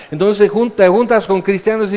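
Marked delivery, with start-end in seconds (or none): none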